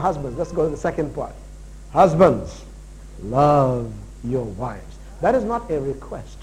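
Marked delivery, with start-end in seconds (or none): 1.9-5.0 low, drawn-out, deliberate scripture quote; slow, weighty command. Real, not acted. Preacher handing husbands their order, slow and weighty. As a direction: set each word down slowly, pitched low.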